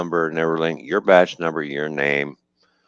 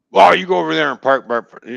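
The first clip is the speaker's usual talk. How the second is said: barked order; 9 semitones higher, 6 dB louder, faster than usual. Retold or imitated. Officer ordering him to park.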